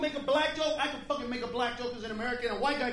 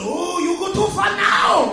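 mock outraged crowd; 5 semitones higher, 10 dB louder, slower than usual.

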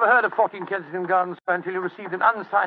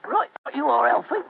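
higher-pitched Cockney mate; amateur Cockney put-on; Cockney accent; 5 semitones higher than usual. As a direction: answer as a Cockney sidekick. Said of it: "My Cockney accent doesn't compare with yours".

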